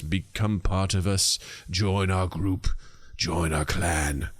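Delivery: low, slow sinister invitation; hammed-up ominous recruiter; mock cult. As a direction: lure them in like a cult leader.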